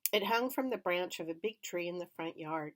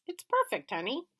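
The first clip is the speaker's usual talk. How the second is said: much higher, tender and pleased; father, smiling; 7 semitones higher than usual. A narrator's reading. Father answering wife, pleased. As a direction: agree fondly. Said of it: "smiled back and said".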